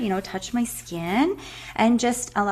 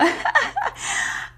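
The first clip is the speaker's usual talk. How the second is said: sudden high airy laugh through tears; teary airy giggle; high breathy laugh. Real, not acted. Laughing at her own tears.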